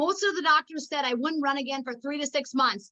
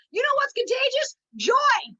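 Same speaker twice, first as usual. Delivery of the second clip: excited rally; 8 semitones higher, 5 dB louder than usual. Real, not acted. Rousing the audience.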